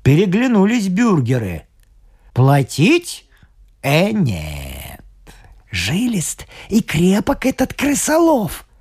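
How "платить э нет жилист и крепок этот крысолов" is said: sly mayor whisper. Acted. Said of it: "шепнул бургомистр".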